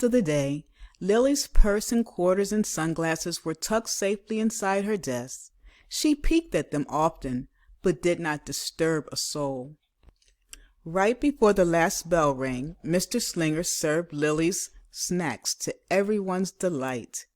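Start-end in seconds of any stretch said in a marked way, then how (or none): none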